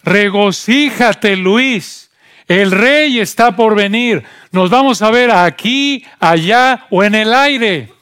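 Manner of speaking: imitating a loud, high, exultant greeter; high-pitched gleeful holler, drawn out; joyful shouting. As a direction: shout it joyfully at full lung, words stretched out. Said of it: "gritando a pulmón abierto; con este tono de voz".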